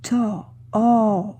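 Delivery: "To, oh" with the open O drawn out; open O demonstration. Shown as a demonstration. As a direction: stretch the open vowel in "oh". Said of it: "on entend le O, le O triste".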